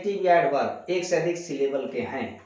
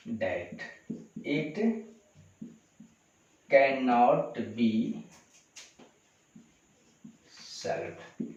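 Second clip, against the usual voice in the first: long pauses between each word, very slow; word-by-word dictation; 4 semitones lower, 6 dB quieter, much slower than usual. Shown as a demonstration. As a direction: spell it out for students copying. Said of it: "teacher dictates rest of sentence". (हर शब्द रुक-रुककर बोलना)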